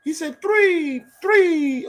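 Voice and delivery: high, bright sing-song count of three; TV host counting. Retold or imitated. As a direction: count like a kids' show host.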